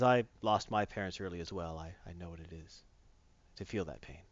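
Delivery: low, soft, subdued confiding; understated acted tenderness; quiet sincerity. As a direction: confide it gently, dropping very quiet. Hero gently confides shared loss.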